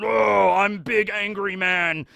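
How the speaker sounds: slow, hammed-up brute voice; big angry man. Mocking the Marine captain as a slow brute. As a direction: play a dumb hulking bully, slowly. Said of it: "the benevolent King of the Seas arrives".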